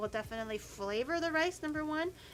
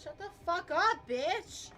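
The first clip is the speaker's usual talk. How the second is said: irritated, higher-pitched outburst; real yell; 7 semitones higher, slower than usual. Real, not acted. Cook cursing at the stove, a sudden irritated yell. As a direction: snap at it in a sudden rise of pitch. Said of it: "I was yelling at the stove".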